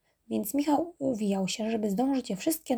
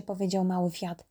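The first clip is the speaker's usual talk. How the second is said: usual speaking voice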